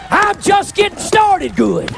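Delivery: high, slow, drawn-out holler; preacher's shout. Real, not acted. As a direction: holler it high and stretch each word. Preacher's climax, then repeated low.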